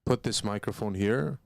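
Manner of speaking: ordinary delivery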